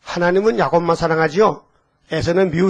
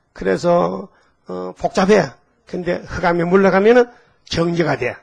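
ordinary delivery

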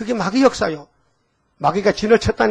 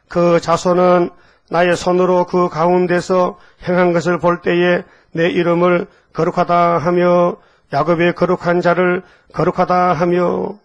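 slow scripture reading; slower than usual. Acted. Reading scripture verse aloud.

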